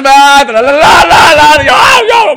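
high, loud shouted no no no; retold outburst. Son losing it at his mother, shouting. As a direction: yell it out of control.

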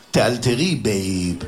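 cool low offhand quip. Retold or imitated. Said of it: "אמרתי לה". (עקיצה נינוחה ונמוכה לאישה)

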